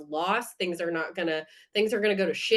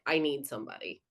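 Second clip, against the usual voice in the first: "I need somebody" lower and softer, a raw plea; vulnerable plea; 6 semitones lower, 6 dB quieter, faster than usual. Retold or imitated. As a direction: let "I need somebody" sink low and soft. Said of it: "than being like".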